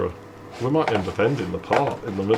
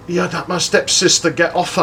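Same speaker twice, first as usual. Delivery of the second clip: imitated indignant challenge, pitched up; higher voice of an affronted lad butting in; Danny; 6 semitones higher than usual. Stepbrother demanding he get off her, imitated indignation.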